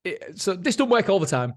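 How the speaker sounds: laughing about his wife's jab. Real, not acted. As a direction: still laughing as he speaks. Laughing after wife joke.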